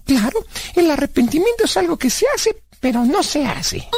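put-on high-pitched kid character explaining; high cartoonish know-it-all; childlike Blas, confident. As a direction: play Blas, high and sure of himself.

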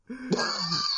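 a burst of high-pitched laughter; high giggle at the fake beard joke. Real, not acted. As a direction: let a squeaky laugh escape.

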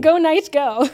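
loud cheer back with a laugh; team cheer. Real, not acted. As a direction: chant it like a fan. Echoes the cheer.

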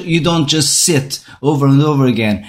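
ordinary talk

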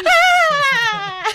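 high, loud startled cry; scared yelp. Real, not acted. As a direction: yelp in fright. Reacting to creepy voice.

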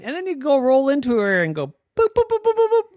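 rising, high, loud boops; playful boops. Acted. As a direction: bounce the boops gleefully. Acting out rolling into queen.

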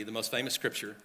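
ordinary talk, nothing marked

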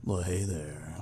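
slow sultry whisper. Acted. Cohost greeting listeners in a sultry whisper. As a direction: purr it out, seductive and hushed. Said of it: "I can do sultry Zuby".

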